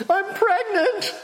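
wife tells him on the phone, tearful. Retold or imitated. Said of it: "She's crying".

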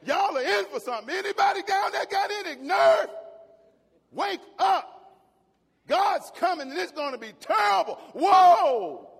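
loud, rising shouted warning; urgent angel's warning. Acted. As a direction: holler to wake them all up. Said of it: "He wasn't quiet".

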